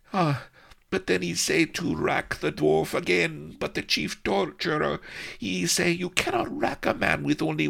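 put-on high, accented girl, near tears by the end; pathetic. Melliflua pitifully finishing her tale for Avery. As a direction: tell it pitifully, voice breaking off. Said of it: "Melliflua concluded pathetically".

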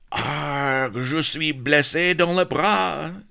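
high, wounded Frenchman's wail; hammed-up French cry of pain; gasped cry. A narrator's reading. Happy Dan hit by an arrow, high pained French cry. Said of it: "gasped Happy Dan".

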